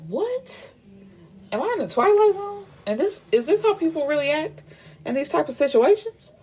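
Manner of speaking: high-pitched, baffled rising questions; incredulous. Real, not acted. Reader asking if she's in the Twilight Zone, incredulous. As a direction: voice shoots way up in disbelief. Her own disbelief at the story.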